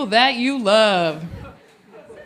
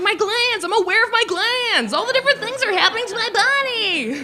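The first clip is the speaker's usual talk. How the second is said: put-on excited kid; 10 semitones higher than usual. Kid high on sour candy.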